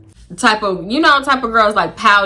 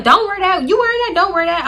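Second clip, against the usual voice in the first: bossy friend; 9 semitones higher, 3 dB louder than usual. Retold or imitated. Friend's pushy orders.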